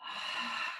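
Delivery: soft unvoiced sigh out; breathy sigh. Real, not acted. Teacher in side stretch, softly sighing it out. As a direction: let the air go without voice. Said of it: "a good way is just to release".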